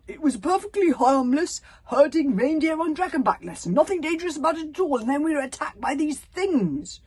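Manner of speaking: high, loud outburst; flustered excuse. A narrator's reading. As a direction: protest your innocence in a rush of alarm. Flustered character makes excuses.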